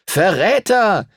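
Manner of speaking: hoher, langgezogener Ruf. A narrator's reading. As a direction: ruf ihm empört hinterher. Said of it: "rief Timothy ihm hinterher". (Timothy ruft dem abtrünnigen Edward empört hinterher)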